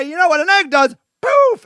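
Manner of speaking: normal talking voice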